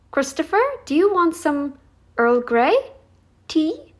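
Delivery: high, rising mock baby talk; imitating her sweet babying tone, lightly. Mocking how the vlogger talks to her partner, in high lilting baby talk. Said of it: "she's trying to baby him".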